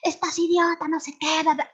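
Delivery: high, loud road-rage yell; yelling at a cyclist. Pretend. Driver yelling at the cyclist who cut in, angry loud yell. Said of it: "le gritamos al de la bicicleta".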